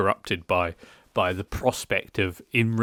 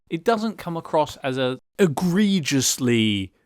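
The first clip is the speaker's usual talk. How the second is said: usual speaking voice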